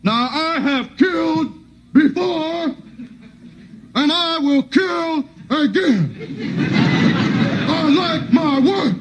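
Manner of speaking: high, slow, deliberate drill sergeant; menacing sergeant. Retold or imitated. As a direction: savor every threat slowly. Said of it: "the sergeant would be bad".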